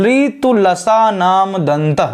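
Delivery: slow word-by-word reading of ऋतु लसा नाम दंत; very slow recitation. Shown as a demonstration. Teacher spelling the sutra slowly, word by word. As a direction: space out each word of the sutra. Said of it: "एक एक अक्षर ध्यान रखना है".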